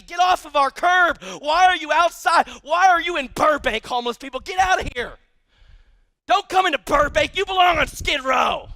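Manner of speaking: mock a resident shooing someone off the curb; indignant scolding. Acting out scorn at homeless people.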